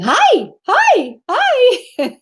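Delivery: put-on high, loud kid's hellos; excited child greeting. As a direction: mimic a small child greeting a stranger. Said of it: "laughing when Adelia was, um, saying".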